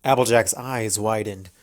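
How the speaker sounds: ordinary delivery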